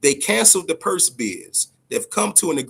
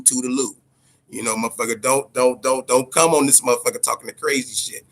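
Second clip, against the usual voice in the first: ordinary delivery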